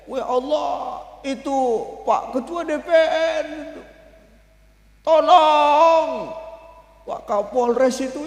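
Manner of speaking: high, drawn-out wailing plea; acted tearful lament, stretched and high; mock weeping. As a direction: wail each name slowly, imitating someone crying. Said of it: "Nangis Rasulullah".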